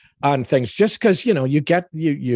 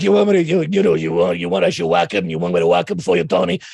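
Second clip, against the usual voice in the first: New York Italian mobster. Acted. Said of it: "more like a New York Italian guy".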